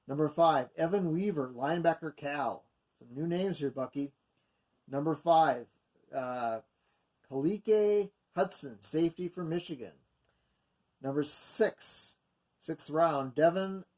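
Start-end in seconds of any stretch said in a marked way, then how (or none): none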